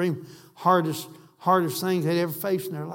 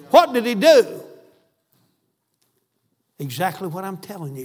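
usual speaking voice

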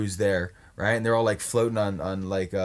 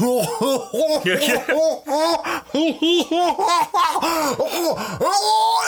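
long, high cackling laugh; helpless high-pitched laughter; high laughing fit; 4 dB louder than usual. Real, not acted. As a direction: crack up, high and loud. Laughing fit at own story.

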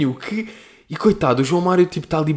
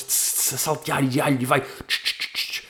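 sizzling sound effect; 7 dB quieter than usual. Retold or imitated. Imitating sizzling pan.

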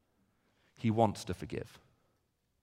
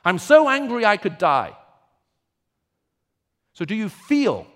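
loud, rising preacher's fervour on Jonah's outrage; impassioned preaching; 10 semitones higher, 11 dB louder than usual. Acted. Preacher to congregation on Jonah's rage, loud and fervent. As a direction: build the outrage, pressing hard.

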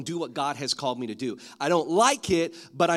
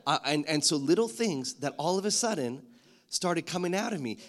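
normal talking voice

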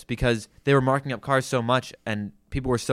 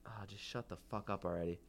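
muttered annoyance; 2 semitones lower, 16 dB quieter than usual. Retold or imitated. Inner thought about annoying customer.

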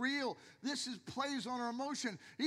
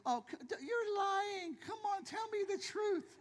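much higher, hammed-up accusing yell; mock shouting wife; 9 semitones higher than usual. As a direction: play the wife snapping at a fibbing husband. Wife voiced accusing husband of lying.

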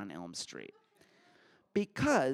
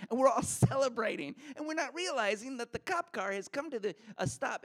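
excited; 10 semitones higher, faster than usual. Real, not acted. Celebrating with laughter.

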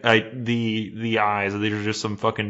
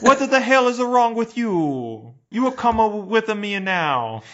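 cartoonish Italian officer scolding tourists; mock Italian cop; 11 semitones higher than usual. Police voiced again.

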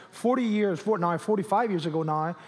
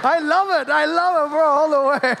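high, loud, laughing delight; excited cheer; 8 semitones higher, 8 dB louder than usual. Real, not acted. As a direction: whoop it up at a fellow fan. Cheering a fan's Cowboys shirt.

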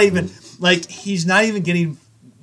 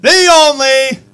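higher, louder, slow hammed-up announcer call; arena announcer; 9 semitones higher, 8 dB louder, slower than usual. Podcast host introducing the guest like an arena announcer. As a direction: boom it out like a PA announcer hyping the team.

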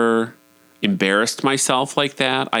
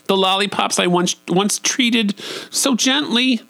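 mock-tender; 10 semitones higher than usual. Real, not acted. Mock-tender lament over lollipops.

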